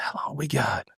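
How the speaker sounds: hushed whisper-like retelling; retold whisper. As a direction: ask quietly so the turkey won't hear. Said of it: "Zane whispers back".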